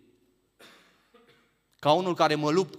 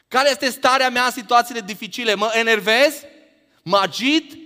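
pressing questions, raised high and loud; 10 semitones higher, 8 dB louder than usual. Shown as a demonstration. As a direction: fire the questions at the congregation. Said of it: "question asked twice, quiet then loud".

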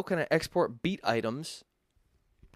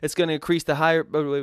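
noticeably louder, pushed voice; louder demo talk; 3 semitones higher, 7 dB louder than usual. Shown as a demonstration. Showing viewers loud vocal dynamics, pushed louder. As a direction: raise the volume to show a loud peak. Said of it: "if I talk louder".

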